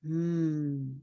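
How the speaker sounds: long, thoughtful hum; pondering hum. Acted. Listeners integrating before they can know, pondering hum. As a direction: hum as if slowly absorbing it. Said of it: "So you're just all like".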